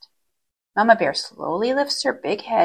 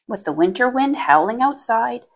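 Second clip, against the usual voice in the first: usual speaking voice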